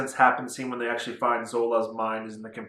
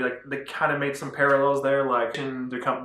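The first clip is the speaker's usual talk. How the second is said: ordinary talk, nothing marked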